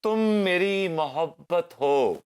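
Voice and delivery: high, slow, drawn-out declaration; verse recitation. Acted. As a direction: declaim it like a love couplet, slowly. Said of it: "अर्ज किया है कि". (शायराना अंदाज़ में धीरे-धीरे)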